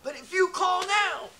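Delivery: high, upbeat infomercial pitch; home shopping host. Pretend. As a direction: sell it like a late-night TV ad. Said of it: "make an infomercial of it".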